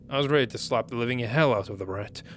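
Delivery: ordinary talk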